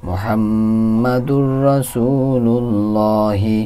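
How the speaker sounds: মুহাম্মাদুর রাসূলুল্লাহি chanted very slowly, long held vowels; slow recitation. Shown as a demonstration. Teacher reciting the full phrase for students, very slow. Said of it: "হরকতের উচ্চারণ দিয়ে পড়া হয়".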